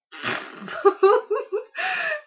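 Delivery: a loud, high real laugh; high burst of laughter. Real, not acted. Mother laughing at her own story, high and loud. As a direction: break into a big high laugh.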